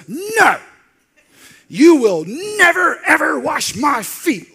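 high, loud, slow outraged protest; indignant shout. Acted. Peter refusing Jesus washing his feet, shouting high. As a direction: refuse it at the top of your voice.

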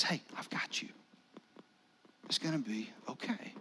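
soft whispered reassurance; reassuring whisper. Acted. Jesus to terrified Peter, soft reassuring whisper. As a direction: whisper it gently, calming him.

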